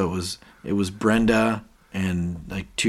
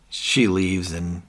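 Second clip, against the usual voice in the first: normal talking voice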